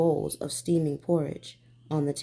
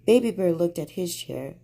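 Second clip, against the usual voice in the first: usual speaking voice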